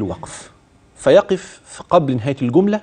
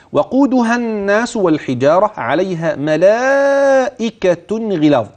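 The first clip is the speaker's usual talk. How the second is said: slow, with the long a in ملائكة held, higher; connected madd shown; 6 semitones higher, slower than usual. Shown as a demonstration. Sheikh showing the third madd, slow and high. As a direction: stretch ملائكة out. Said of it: "أدي المد التالت".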